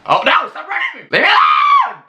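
real panic, shouting to be left alone; terrified yelling. Real, not acted. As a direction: scream at the thing chasing you. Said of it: "sorry if I'm yelling".

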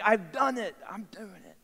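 a hint of a triumphant kid, fading soft; lightly voiced boy lost in his triumph; imitated thrilled child. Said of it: "He's just so in the moment".

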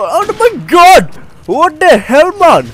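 high, drawn-out exasperated cry; very annoyed. Real, not acted. Gamer complaining about the gun, very annoyed. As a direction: wail at the game, incredulous and annoyed. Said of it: "I'm getting very annoyed".

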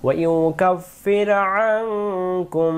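slow melodic tajweed chanting; slow chanted Quranic recitation. Shown as a demonstration. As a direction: draw the vowels out in a sung recitation. Recites verse demo.

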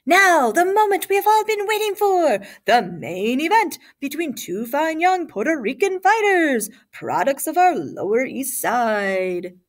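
booming fight-night introduction, swooping high then settling; upbeat ring announcer. A narrator's reading. Announcer introducing two fighters to the crowd, upbeat booming call. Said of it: "the announcer with his upbeat tone".

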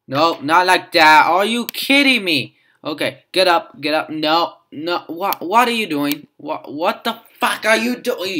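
loud, high exasperated shouting; frustrated yelling. Real, not acted. Player yells at the panda's mistake, loud and frustrated. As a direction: scold the panda for messing up.

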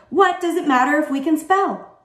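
high, worked-up protest; exclaiming. A narrator's reading. Pupil challenging his teacher about spelling, exclaiming. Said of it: "Myron exclaimed".

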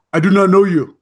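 put-on Ugandan accent. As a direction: imitate a Ugandan guard like the meme. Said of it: "it was spot on".

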